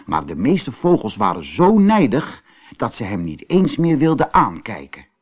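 ordinary delivery

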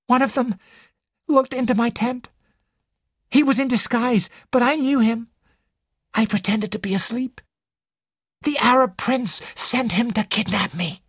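high, breathless-with-drama storytelling; dramatic Ginevra, frightened. Acted. Ginevra tells detective of kidnap attempt, dramatically. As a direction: spin the tale in a high, urgent voice.

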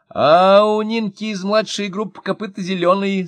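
put-on high, loud child's voice; little boy. A narrator's reading. Grandson reporting kindergarten news, high child voice. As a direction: chirp it like an excited little kid. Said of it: "сообщил Герасик".

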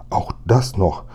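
ordinary delivery

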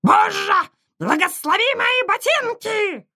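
high, shrill parrot cry; squawking parrot. A narrator's reading. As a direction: screech it like a startled parrot. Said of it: "хриплый крик и возглас".

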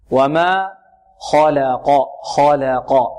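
chanted خلق with heavy kha, thin lam, heavy qaf; heavy-light-heavy. Shown as a demonstration. Teacher modelling the letters' weights, light lam. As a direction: keep the l of خلق light between two thick consonants. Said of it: "مفخمة".

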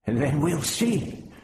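retorting. Acted. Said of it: "Propillus retorted".

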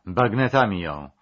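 loud, urgent order; agitated shout. Acted. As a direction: bark the command in a frenzy. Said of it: "zawołał Jermakow, nie kryjąc zdenerwowania". (głośny, zdenerwowany okrzyk)

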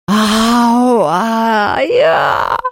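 high crying ghost wail; ghostly wailing howl. A narrator's reading. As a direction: howl and sob like a heartbroken ghost. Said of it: "завыл Хуга".

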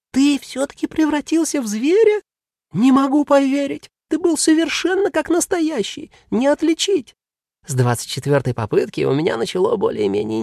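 high, astonished voice; can't believe his friend's a cat; amazed demon. Acted.

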